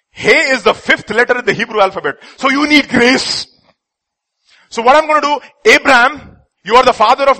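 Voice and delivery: loud, high booming God promising grace; God speaking to Abraham, loudly; lightly voiced God. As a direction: proclaim like God blessing Abraham. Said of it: "God came and said".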